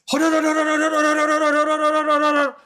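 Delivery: urgent rising hold on chant; frantic. Real, not acted. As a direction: stammer hold on in a panic. Frantic repeated hold on again.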